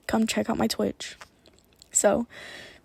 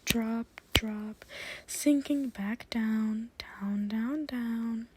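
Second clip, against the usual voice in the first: breathy hypnotic guidance; whispered hypnosis; 6 dB quieter than usual. Acted. Sends listener back down.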